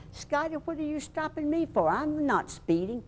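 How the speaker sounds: high-pitched acted protest, slowing at the end; indignant protest. Acted protest to police chief.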